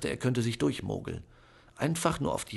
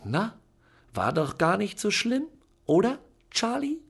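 very high, bright, mocking question; triumphant aunt; 10 semitones higher, 5 dB louder than usual. A narrator's reading. Aunt gloating at Charlie after the silence. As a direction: gloat over how easy it was. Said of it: "Tante Venetia ergriff als Erste das Wort".

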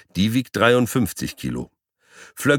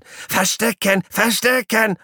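squeaking guinea pig; slower than usual. Acted. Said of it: "immer lauter gequiekt".

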